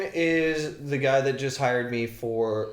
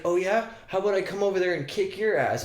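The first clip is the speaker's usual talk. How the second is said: mock comeback; 7 semitones higher than usual. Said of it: "I was like".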